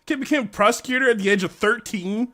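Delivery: loud, high, incredulous; can't believe what you hear; stunned disbelief. Acted. Stunned by thirteen-year-old prosecutor.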